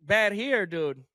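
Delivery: pitched high, taunting the complainers; mocking challenge. Host taunts complaining Americans with a high, mocking challenge.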